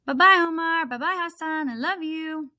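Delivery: put-on high, eager little girl. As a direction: chatter like an excited small child. Little girl saying goodbye.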